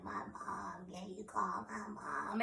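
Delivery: faint, slow, breathy whisper; imitated feeble patient whisper; weak voice. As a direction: plead with no voice left. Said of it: "that's how I sounded".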